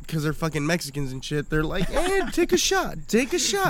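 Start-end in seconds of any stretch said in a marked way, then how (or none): 1.9-3.7 high, slow coaxing "take a shot"; mock pushy party host; urging partygoer. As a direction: egg him on to drink, pitched way up. Mexican relatives urging shots.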